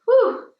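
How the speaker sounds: high, loud whew, shaking off the tears; a big relieved breath out; relieved exhale. Real, not acted. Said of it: "dry up the tears".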